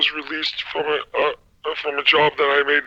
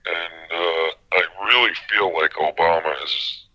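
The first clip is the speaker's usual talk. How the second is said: Jim Gaffigan voice; 10 semitones lower than usual. Real, not acted. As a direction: talk low and deadpan like Gaffigan. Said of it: "sound like Jim Gaffigan".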